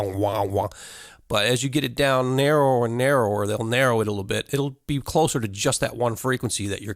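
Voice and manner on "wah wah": wah wah sound. Shown as a demonstration. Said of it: "it sounds like a wah".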